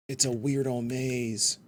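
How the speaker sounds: normal talking voice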